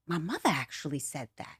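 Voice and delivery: half under her breath, realizing; quiet realization. Retold or imitated. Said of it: "I'm like".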